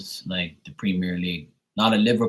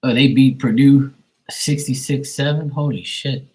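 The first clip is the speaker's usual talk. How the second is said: higher and louder, caught off guard by the score; surprised; 6 semitones higher, 6 dB louder than usual. Real, not acted. Streamer reading a shocking football score, surprised. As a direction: blurt it out in real disbelief.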